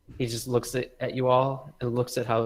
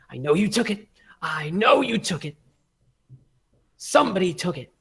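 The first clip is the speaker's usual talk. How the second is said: raised, higher voice, angrily accusing; insistent accusation; 8 semitones higher, 8 dB louder than usual. Acted. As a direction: hammer the accusation home, loud and high. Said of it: "takes a deep breath".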